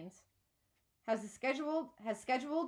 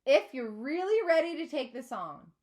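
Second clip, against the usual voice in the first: louder and higher over the noise; raised voice; 5 semitones higher, 8 dB louder than usual. A narrator's reading. Mister Salzar raising his voice over the excited kids. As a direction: project over the room. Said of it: "raising his voice over ours".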